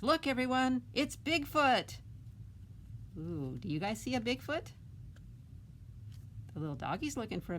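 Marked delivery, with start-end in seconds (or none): 0.0-2.0 excited announcement. Acted. Ben calling everyone to see Bigfoot, excited and high. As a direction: get everyone to look. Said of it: "Ben says".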